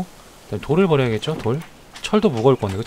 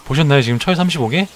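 excited; 3 semitones higher, 7 dB louder than usual. Real, not acted. Huge ore haul surprises him.

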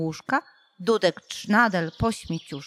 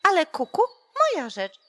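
shrieking cuckoo; 10 semitones higher than usual. A narrator's reading. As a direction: screech back at the rooster. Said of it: "wrzaśnie".

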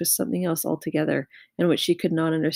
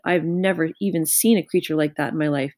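ordinary delivery, nothing marked

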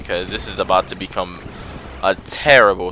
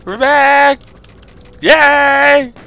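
squealing high and loud; bursting with excitement; excited high voice; 6 dB louder, much slower than usual. Real, not acted. Excited return greeting.

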